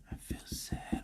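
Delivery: slow, barely audible whisper; faint inner voice; so quiet. Acted. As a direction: whisper it like a tiny knock. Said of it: "because it's so quiet".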